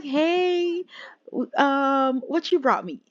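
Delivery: little brother. Acted. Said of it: "Armand's little brother just came out".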